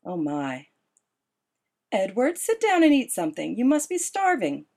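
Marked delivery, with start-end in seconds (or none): 1.9-4.7 higher bright urging; upbeat coaxing. A narrator's reading. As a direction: cheer him to the table. Esme cheerfully urging Edward.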